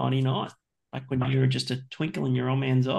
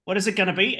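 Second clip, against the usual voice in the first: normal talking voice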